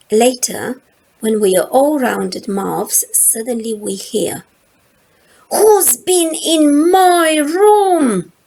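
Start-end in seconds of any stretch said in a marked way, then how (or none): 5.5-8.3 slow, loud, bellowing voice from another room; angry roar. A narrator's reading. Big sister finding her room emptied, furious roar. Angry sibling discovers missing things.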